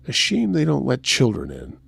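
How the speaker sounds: ordinary delivery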